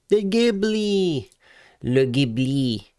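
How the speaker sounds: high, drawn-out croon sliding down; playful mock announcement. Host revealing the one car missing, playfully drawn out. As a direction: stretch the name out in a high playful voice.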